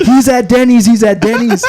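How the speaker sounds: yelling to the others while running; retold yell to coworkers that he's at Denny's; urgent shout.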